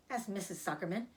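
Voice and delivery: plain reading voice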